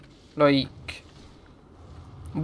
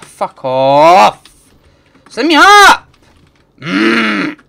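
loud, then much higher and louder; angry yell; 4 semitones higher, 11 dB louder, much slower than usual. Real, not acted. Gamer yelling at the game to send him up. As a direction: bark it at the screen.

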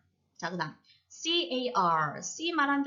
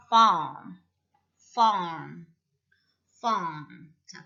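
ordinary talk

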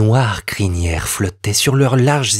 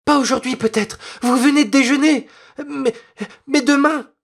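put-on much higher little girl's voice choking on tears; sobbing girl; 13 semitones higher than usual. A narrator's reading. Little girl answering the soldier through sobs, much higher. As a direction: plead while sobs cut her off. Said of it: "ses sanglots la suffoquèrent".

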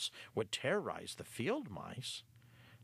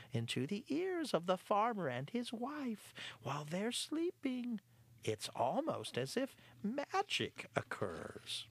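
higher smug cat voice; the cat explaining his trick; lightly voiced sly cat; 11 semitones higher than usual. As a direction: relish the magic. Clarence voiced.

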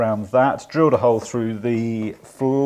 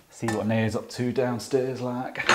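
quiet, put-on northern English accent; 8 dB quieter, slower than usual. Shown as a demonstration. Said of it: "Finished in me northern accent".

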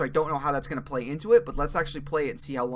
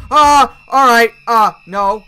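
loud, higher, slowed panic cry; panicked loud yell; 6 semitones higher, 16 dB louder, slower than usual. Real, not acted. Gamer panics at the monster, loud frightened yell. Second scare while playing.

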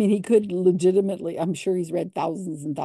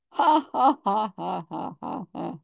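mock laughter; 2 semitones higher than usual. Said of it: "and he says".

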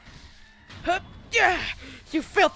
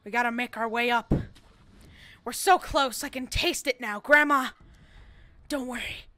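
dramatic monologue; 5 semitones lower, 5 dB quieter than usual. Acted. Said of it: "I was doing, like, a dramatic monologue".

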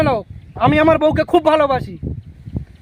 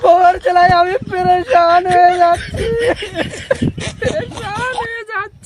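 crying. Acted. Husband fed up with his wife's torment, crying. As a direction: bawl it, breaking into sobs. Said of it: "আর কাঁদিস না ভাই".

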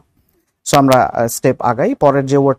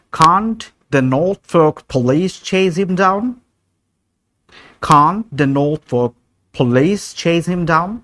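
slow model question; 5 semitones higher, much slower than usual. Shown as a demonstration. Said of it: "একটা sentence বানাই".

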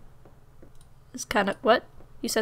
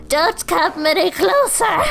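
slow, higher, hammed-up villain warning; funny villain voice; 6 semitones higher, 4 dB louder, slower than usual. Player voicing the burglar warning off the cop, hammed-up and slow. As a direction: ham up a silly villain's threat. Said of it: "it's gonna be a really funny voice".

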